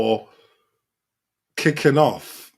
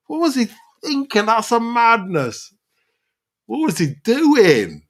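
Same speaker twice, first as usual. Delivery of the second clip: exasperated disbelief; 10 semitones higher than usual. Real, not acted. Exasperated at Di Maria's stamp.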